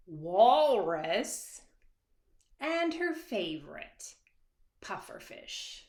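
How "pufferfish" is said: ordinary delivery, nothing marked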